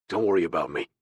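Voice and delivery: a hint of a deep male voice; lightly voiced, unyielding man; deep, firm man. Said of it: "His deep voice allowed no argument".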